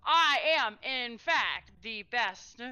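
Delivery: high, slow, grandly drawn-out boast; pompous boaster. Acted. Medius boasts to the island lady, hammed-up pompous. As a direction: strut it like a vain knight.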